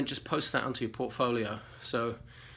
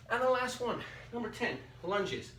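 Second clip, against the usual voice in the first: breathless from exercising; out of breath, puffing between words; 9 semitones higher than usual. Real, not acted. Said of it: "Whew, I'm out of breath".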